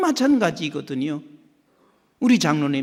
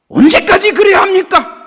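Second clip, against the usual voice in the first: louder, higher whining protest; exasperated complaint; 14 semitones higher, 12 dB louder than usual. Retold or imitated. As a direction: push back at God in frustration. Complaining to God.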